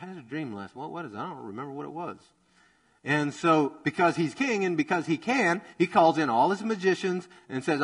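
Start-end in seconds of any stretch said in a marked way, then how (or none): 0.0-2.2 much softer, a half-awake mumble; drowsy, softer puzzled mumble; groggy morning confusion. Retold or imitated. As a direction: fumble for a dream you've already lost. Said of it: "you're like".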